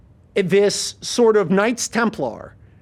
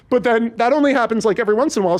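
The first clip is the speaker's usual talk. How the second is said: ordinary talk, nothing marked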